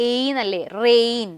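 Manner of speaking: "റെയിൻ" with a held, clear "ay" glide; long AY vowel demo. Shown as a demonstration. Teacher showing how AI is pronounced, "റെയിൻ" with a long ay. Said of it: "എ ഐ എന്ന് നമ്മൾ എങ്ങനെ ശരിക്കും pronounce ചെയ്യുന്നത്".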